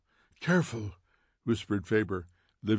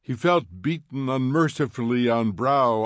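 ordinary delivery, nothing marked

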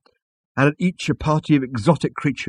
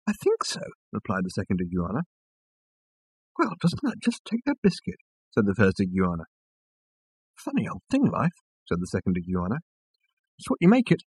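iguana voice; 5 dB quieter than usual. A narrator's reading.

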